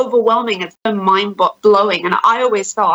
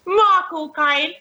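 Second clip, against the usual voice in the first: high, slow cockney impression; hammed-up London film star, pitched up; Michael Caine; 7 semitones higher, much slower than usual. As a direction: stretch out both words in exaggerated cockney. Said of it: "I have to say Michael Caine".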